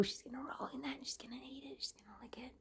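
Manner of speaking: hushed whisper, watching the cats; breathy voiceless whisper. Real, not acted.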